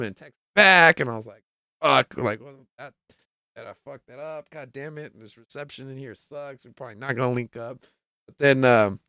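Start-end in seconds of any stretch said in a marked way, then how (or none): 1.8-7.8 much softer, cursing under the breath; frustrated under breath. Retold or imitated. Cursing the bad reception in the venue, soft frustrated grumble.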